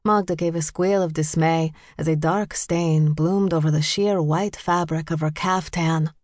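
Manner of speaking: ordinary delivery, nothing marked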